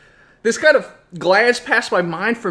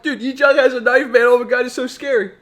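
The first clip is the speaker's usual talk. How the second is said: raised, louder mock-frightened report; scared kids; 5 semitones higher, 4 dB louder than usual. Boys tell the supervisor about the knife, hammed-up fright. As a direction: blurt it like a panicky boy.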